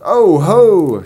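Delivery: loud, high storybook "oh ho"; exclaiming little spring. Acted. Spring hears the girl's woes, loud theatrical exclamation.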